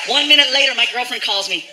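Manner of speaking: ordinary talk, nothing marked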